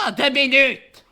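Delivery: high, loud exasperated snap; scolding brother. Acted. Old lady snaps at her noisy brother, high and loud. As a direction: tell him off sharply.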